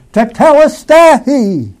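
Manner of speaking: high, loud, drawn-out shout in Greek; crying aloud. Acted. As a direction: cry it out slowly at full force. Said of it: "when he cried aloud".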